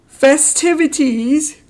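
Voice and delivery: a high, slow, pointed correction; mock correction. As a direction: correct the word with exaggerated emphasis. Correcting word in post.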